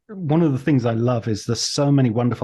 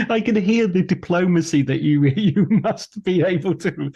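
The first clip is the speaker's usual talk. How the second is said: words through real laughter; amused laughing speech; 7 semitones higher than usual. Real, not acted. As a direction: talk while still laughing, amused. Host laughing while speaking.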